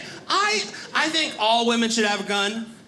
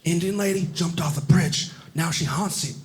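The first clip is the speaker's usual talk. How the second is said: low tour guide; 5 semitones lower than usual. Retold or imitated. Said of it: "And he says".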